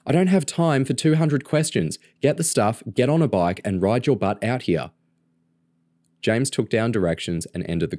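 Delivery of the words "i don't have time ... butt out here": impatient, higher-pitched orders; exasperated telling-off, starting high; snappish. A narrator's reading. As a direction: snap out the orders, out of patience. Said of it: "Kerry snapped".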